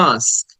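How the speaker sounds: "আস্ক" with the "আ" held long; drawn-out vowel. Shown as a demonstration. Teacher telling students to draw it out, long "আ". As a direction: stretch the opening "আ" of "আস্ক". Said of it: "আটাকে টেনে বলতে হবে".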